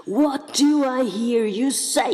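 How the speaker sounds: high, loud, slow outraged cry in a strange voice; furious enchantress. A narrator's reading. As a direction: cry it out, outraged at being deceived. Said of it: "cried the enchantress".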